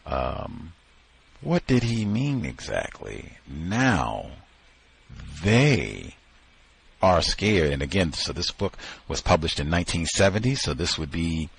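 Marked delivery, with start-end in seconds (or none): 3.5-7.7 "they" alone between long pauses, drawn out; stress on THEY. Shown as a demonstration. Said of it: "for emphasis".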